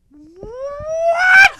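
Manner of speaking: huge, high, drawn-out shriek; mock panicked scream. Fat Man learning it is no drill, hammed-up scream. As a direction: build into an exaggerated screamed disbelief. Retold freak-out at real tornado.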